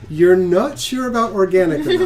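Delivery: laughing through the line. Real, not acted. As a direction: giggle while saying it. GM laughing at the joke.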